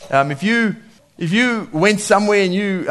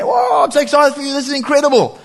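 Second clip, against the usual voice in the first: high, loud delighted cheer; excited congratulations; 10 semitones higher, 3 dB louder than usual. Retold or imitated. Congratulating a friend, loud excited cheer. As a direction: cheer a friend's good news. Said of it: "hit him on the back and say".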